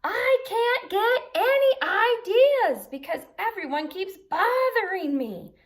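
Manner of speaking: high, loud, slow frustrated howl; howling unicorn. Acted. Grumpy unicorn howls at his pestering friends, loud and high. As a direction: wail it out like a fed-up unicorn. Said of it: "howled Unicorn".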